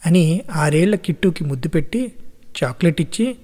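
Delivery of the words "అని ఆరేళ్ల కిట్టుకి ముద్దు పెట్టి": ordinary delivery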